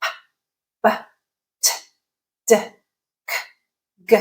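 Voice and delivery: "P, B, T, D, K, G" as bare stop sounds, not letter names; short bursts for "P, B, T, D, K, G", pausing after each; isolated stop sounds. Shown as a demonstration. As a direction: pop out each of "P, B, T, D, K, G" alone. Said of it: "examples of stop sounds in English".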